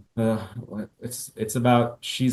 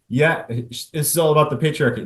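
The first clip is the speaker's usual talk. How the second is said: higher, louder flippant jab; 6 semitones higher, 6 dB louder than usual. Shown as a demonstration. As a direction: toss it off as a joke, not seriously. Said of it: "Now I'm being flippant".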